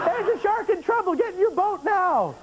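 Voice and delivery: high, urgent mock hotline call; shrill alarm for a shark in trouble; mock urgent caller.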